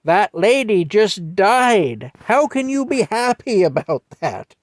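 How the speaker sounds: put-on high, slow, earnest child telling off a grown-up; scolding little kid. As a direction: wag your finger like a three-year-old. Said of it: "And I say".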